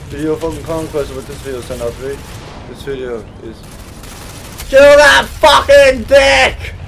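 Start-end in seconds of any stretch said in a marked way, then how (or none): 4.7-6.7 slow, loud, high yell; angry shout. Real, not acted. Player vowing to kill his nemesis, slow angry shout. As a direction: stretch each word into a shout.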